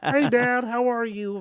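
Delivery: very high, loud cartoon kid; mock your twelve-year-old with a high puppet voice; Kermit voice. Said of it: "Why do you give him a Kermit voice?".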